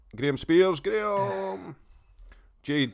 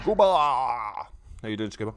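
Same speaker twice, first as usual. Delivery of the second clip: odd sing-song greeting; 4 dB louder than usual. Real, not acted. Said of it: "I don't know why I say it like that".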